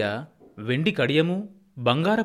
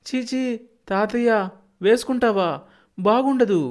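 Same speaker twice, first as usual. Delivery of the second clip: put-on much higher boyish voice, squeamish and louder; child's disgust; 10 semitones higher, 3 dB louder than usual. A narrator's reading. Grandson scoffing at grandfather's bangles, high childish disgust. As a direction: recoil like a small boy from something gross.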